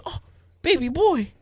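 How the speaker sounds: put-on high, cooing little-girl voice; imitated big sister. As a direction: coo at a fussy baby as his sister. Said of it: "She's such a good big sister".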